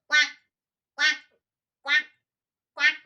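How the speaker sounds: loud nasal quacks; twang quack drill. Shown as a demonstration. Teacher leading a twang quack exercise, loud. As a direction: bark out quacks through the nose. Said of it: "Se practica de la siguiente forma".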